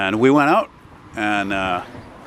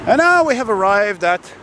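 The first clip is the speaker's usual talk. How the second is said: slow, grand mock announcement; announcer voice; 8 semitones higher, 3 dB louder than usual. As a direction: announce the arrival like a tour guide.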